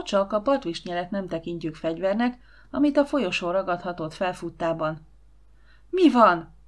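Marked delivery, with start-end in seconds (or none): 5.9-6.5 louder, higher startled demand; shouted question. A narrator's reading. Woman rushing into the hall, shouting to know what happened. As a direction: shout it out sharply and high. Aunt yelling as she rushes in.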